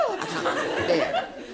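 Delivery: very high, slow squeal of dread; mock terror. Phobic man hearing footsteps behind, theatrical terror. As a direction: squeal it in exaggerated panic.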